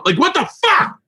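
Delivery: high-pitched incredulous cry; mock-outraged punchline; exasperated outburst. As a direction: voice leaps up in comic disbelief. Climax of the mock rant.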